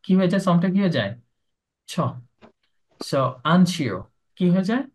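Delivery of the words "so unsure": "unsure" very slow, t and y fused into ch; "unsure" ছ উচ্চারণে ধীরে; linked t-y as ch. Shown as a demonstration. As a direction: stretch "unsure" so the ch link is clear. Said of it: "the moment it becomes ছ".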